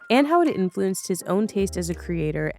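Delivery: smooth announcer read over music; podcast outro read. Acted. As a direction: wrap up the episode like a host.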